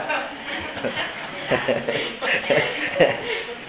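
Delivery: a long quiet chuckle fading out; soft laugh. Real, not acted. Monk chuckling to the crowd at his joke. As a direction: laugh softly at your own joke.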